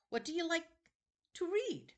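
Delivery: put-on high, eerie old man's voice; a wheedling ancient keeper of books; creepy old librarian. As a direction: play a strange, eager old librarian. Librarian character voice.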